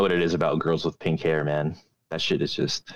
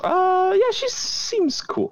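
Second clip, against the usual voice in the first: high silly voice; slower than usual. Pretend. High put-on voice.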